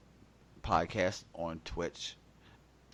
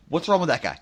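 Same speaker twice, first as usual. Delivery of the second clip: mock argument; 7 semitones higher, 14 dB louder than usual. Acted ER waiting room argument.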